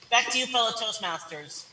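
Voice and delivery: usual speaking voice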